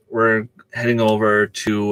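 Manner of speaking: normal talking voice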